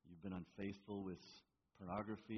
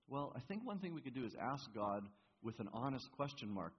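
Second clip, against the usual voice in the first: usual speaking voice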